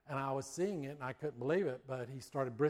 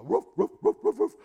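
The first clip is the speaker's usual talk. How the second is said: high, loud mock barking; imitated dog barks; 9 semitones higher, 12 dB louder than usual. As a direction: bark like an excited collie, pitched up. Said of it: "but she'd go".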